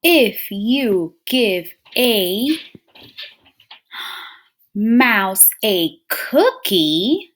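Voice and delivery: drawn-out read-aloud, pausing between phrases; slow storybook reading. Acted. Teacher reading the book aloud to scholars, slow and drawn out. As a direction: savor each phrase for the class.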